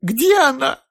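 high, loud tearful plea; through tears. A narrator's reading. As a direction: cry the question out. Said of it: "сквозь слёзы".

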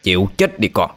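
taunting villain. Acted. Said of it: "giọng nói đã xuất hiện trước".

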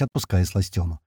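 ordinary delivery

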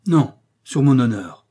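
loud protesting cry; exclaiming. A narrator's reading. The king's guard protesting to Aramis, loud exclamation. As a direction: burst out, swearing on your honour. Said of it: "s'écria le garde de Sa Majesté".

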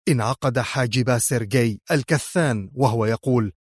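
ordinary delivery, nothing marked

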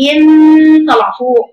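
إن held long and high with nasal hum. Shown as a demonstration. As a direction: lift إن up and hold the ن. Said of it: "صوت حتى فوق أهو".

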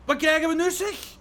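surprised. Acted. Surprised at strange scene.

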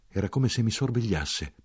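usual speaking voice

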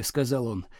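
ordinary delivery

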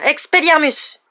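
high, loud incantation; cried-out spell. A narrator's reading. Pupil draws his wand just in time, crying out. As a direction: shout the spell with full attack. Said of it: "et s'écria".

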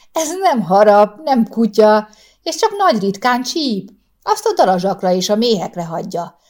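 loud, bright and chatty reassurance; breezy, cheerful call across the graves. A narrator's reading. Cheerful neighbour woman calling out to the old man. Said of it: "zavarta meg egy kedélyes hang".

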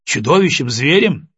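ordinary delivery, nothing marked

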